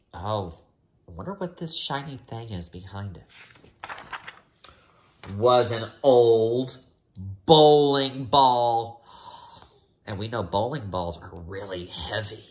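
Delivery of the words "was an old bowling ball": slow and loud, leaning on each word; dramatic reveal. Acted. Teacher revealing the bowling ball, slow loud build. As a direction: land the reveal heavily.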